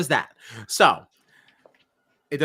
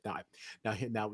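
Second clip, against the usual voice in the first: ordinary talk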